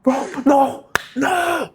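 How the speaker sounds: high, loud excited yells; excited yelling. Real, not acted. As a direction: shout at the screen in excitement. Player juked in game.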